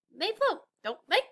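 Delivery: high, light penguin voice. A narrator's reading. Penguin asking the elf about ornaments, high and light.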